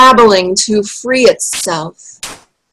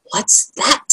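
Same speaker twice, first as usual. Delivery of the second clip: hushed, softer and higher question; whispered; 4 semitones higher, 6 dB quieter than usual. A narrator's reading. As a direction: whisper it warily to a companion. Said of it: "he whispered to Spittleworth".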